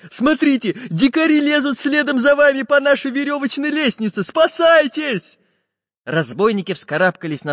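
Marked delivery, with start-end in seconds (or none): 0.1-5.4 high, loud warning cry; Karandash's alarm. A narrator's reading. Little artist warns the pirates of climbing savages, alarmed. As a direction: cry out, stretching the last word. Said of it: "крикнул пиратам Карандаш".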